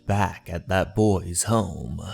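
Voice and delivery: plain reading voice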